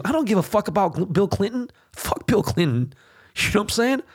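can't believe what people are saying; fired-up, high-pitched rant; incredulous outburst. Real, not acted. Heated rant dismissing Bill Clinton.

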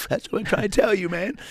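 high-pitched stoner agreement; imitated pothead. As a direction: play a stoner, voice pushed up. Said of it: "Potheads were like".

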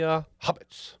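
high, put-on grumpy wizard voice; grumpy Gandalf voice. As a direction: play it as a cranky old wizard. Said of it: "you have to say it like that in the grumpy Gandalf voice".

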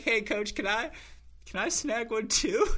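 high-pitched, wheedling mock plea; retold envious little ask; pleading request.

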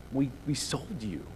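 soft, hushed, halting confession; quiet guilty stammer; stunned stammer. Acted. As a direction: barely get the words out in shock. Brothers stammer in shock.

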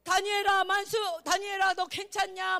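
살짝 왕 흉내로 크게 부르기. Acted. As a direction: call out to Daniel as a king, lightly acted. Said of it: "다니엘한테 막 왕이 와서 뭐라 해요". (다니엘을 부르는 왕을 크게 흉내)

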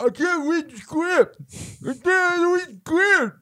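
high, slow whiny actor voice; whining high-pitched complaint. Retold or imitated. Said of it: "So he's like".

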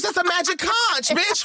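SpongeBob magic conch quote. Retold or imitated.

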